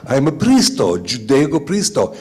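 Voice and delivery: loud mock Italian; fake Italian. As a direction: add an O to every word. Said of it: "You just add an O".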